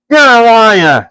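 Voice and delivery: high, loud troll accusation; troll William calling Bert a liar; angry troll. A narrator's reading. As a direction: spit it back at him. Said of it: "said William".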